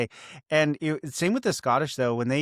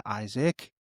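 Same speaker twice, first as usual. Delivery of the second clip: usual speaking voice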